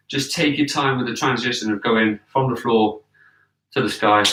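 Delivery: ordinary delivery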